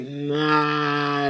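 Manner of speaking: long, high, drawn-out nah; a sneering, dragged-out nah; dismissive nah. Retold or imitated. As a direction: brush it off, stretching the word slowly. Dismissing a two-faced friend.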